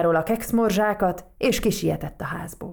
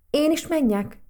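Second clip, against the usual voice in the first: reluctant question; 9 semitones higher, 5 dB louder than usual. A narrator's reading. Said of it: "szomorú pillantást vetve".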